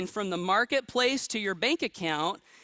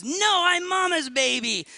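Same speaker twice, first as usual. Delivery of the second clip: put-on high, loud, drawn-out little-kid protest; imitated toddler; 9 semitones higher, 5 dB louder, slower than usual. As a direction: mimic a toddler insisting, high and slow. Imitates two-year-old son protesting.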